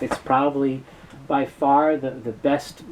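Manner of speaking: ordinary delivery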